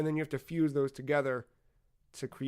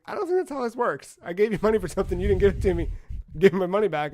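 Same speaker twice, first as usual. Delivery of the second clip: higher, louder acted complaint; retold indignation; 4 semitones higher, 5 dB louder, faster than usual. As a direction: imitate an indignant customer demanding a refund. Acted money dispute with the girl.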